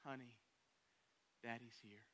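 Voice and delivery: soft, low, gentle reassurance; father comforting his frightened daughter, softly and tenderly. Pretend. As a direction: hush her like a loving dad. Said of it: "What do we say?".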